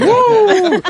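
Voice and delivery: high, loud laughing whoop; excited whoop. Real, not acted. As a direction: whoop it up like a cheering fan. Cheering after pig noise.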